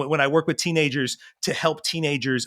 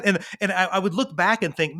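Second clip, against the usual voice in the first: ordinary talk, nothing marked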